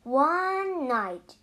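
ordinary delivery

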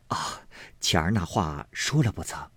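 asking quietly so no one hears; hushed asking. A narrator's reading. Liu asks quietly. (压低声音问)

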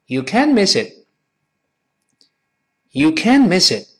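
slow, "can't" high and stressed; read-along model. Shown as a demonstration. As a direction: punch "can't", link "miss it", slowly. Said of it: "我们跟老师一块儿读一遍".

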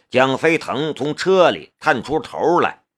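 usual speaking voice

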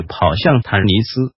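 plain reading voice

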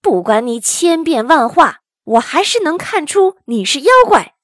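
storybook hero roaring an accusation; angry bellow. A narrator's reading. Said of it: "喝道". (愤怒大喝，越说越高)

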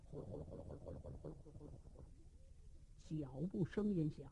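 very low, soft, rapid rumble; rumbling footsteps. Acted. Storyteller imitating approaching soldiers' footsteps, fast and low. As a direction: patter the marching feet under your breath.

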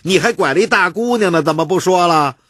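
high, loud, fast jeering shout; a bystander hollers an accusation, loud and quick; clamoring heckler. Acted. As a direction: yell it out from the crowd, rushing. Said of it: "突然有个人叫嚷".

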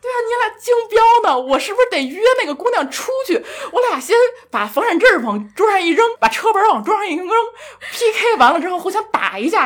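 high, worked-up sarcastic riff; mock outrage. Woman joking about battling a rival for him, mock outrage. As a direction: play up a ridiculous showdown, pitched high. Sarcastic acting-out of a bidding contest.

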